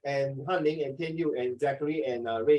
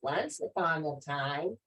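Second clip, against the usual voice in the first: nursery storytime; 6 dB quieter, slower than usual. Shown as a demonstration. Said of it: "reading to nursery children".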